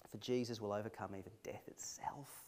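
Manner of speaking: awed soft whisper. Real, not acted. Preacher assuring listeners in a whispered hush. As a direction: lean in and whisper the promise.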